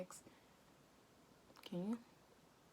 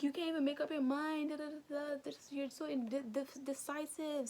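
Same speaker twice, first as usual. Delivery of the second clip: mimicking angry boy; 8 semitones higher than usual. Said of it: "and he's like".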